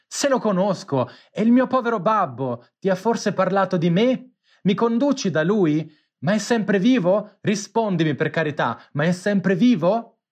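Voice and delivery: high, urgent, breathless questions; anxious pleading. A narrator's reading. Pinocchio begging the pigeon for news of his father, high and urgent.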